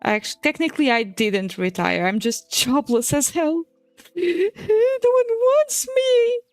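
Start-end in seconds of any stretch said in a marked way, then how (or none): none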